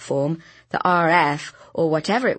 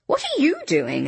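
parrying; 8 semitones higher than usual. A narrator's reading. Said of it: "I parried".

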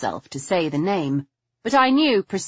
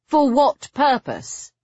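high, slow, pointed question; demanding; 9 semitones higher, 4 dB louder, slower than usual. A narrator's reading. Narrator questioning Henry about the mask's purpose, demanding. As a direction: demand an answer, pitched well up. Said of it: "I demanded".

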